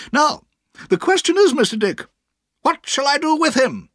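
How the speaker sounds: put-on high, firm old aunt. A narrator's reading. Aunt addresses Mister Dick firmly. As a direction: settle him down with authority.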